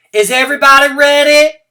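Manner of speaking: very high, loud call to the class. Acted. Teacher asking the racers if ready, loud call. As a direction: call out to the lined-up kids. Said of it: "she asked".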